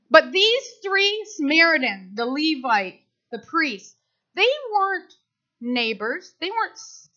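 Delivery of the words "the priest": ordinary talk, nothing marked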